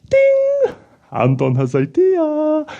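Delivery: high, slow puppet voice with a ding; puppet Anton. Acted. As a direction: chime then announce the idea in a high puppet voice.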